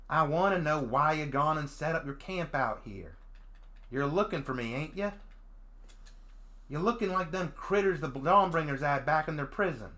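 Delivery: lightly voiced feral Southern girl; rough southern country girl. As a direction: interrogate him with a light Southern country accent. Voicing the feral Dragonute girl.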